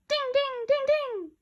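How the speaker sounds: high bell-like dings; chiming dings. Acted. Kids imitating the sale notification, high chimes. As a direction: ring out like a chime.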